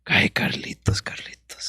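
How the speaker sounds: exasperated mother under the breath; whispered mom imitation. Mom reacting to son moving back in, whispered imitation. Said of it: "She's like".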